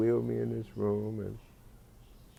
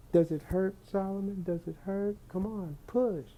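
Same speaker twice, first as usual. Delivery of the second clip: coaxing lady voice; 12 semitones higher than usual. Retold or imitated. Nurse coaxing the patient to push, high mock sweetness. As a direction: urge him gently to push like a midwife. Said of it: "is what the lady was saying".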